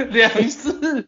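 high-pitched laughing. Real, not acted. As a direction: laugh while correcting him. Laughs at four-word answer.

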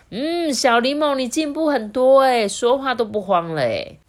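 high, loud impressed auntie; praising auntie. A narrator's reading. Doctor auntie praises Little Lemon's progress, impressed. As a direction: gush over a child's progress.

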